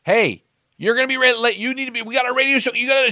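high, loud, fast scolding wife; imitating an angry wife scolding fast and loud; railing on him. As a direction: chew him out like a nagging wife. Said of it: "she started just railing on him".

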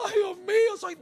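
mock crying wail. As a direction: ham up a crying man's wail. Imitating a weak crying man.

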